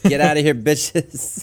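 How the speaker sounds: mocking snap. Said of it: "they just hear me snapping".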